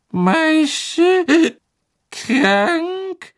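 hohe Elfenstimme, stockend; erschrockene Elfe. A narrator's reading. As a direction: fassungslose Hauselfe. Winky voiced by narrator. (hohe, stockende Elfenstimme)

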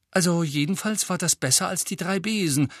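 high, stubborn retort; defiant. A narrator's reading. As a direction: insist defiantly. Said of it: "sagte Hermine trotzig".

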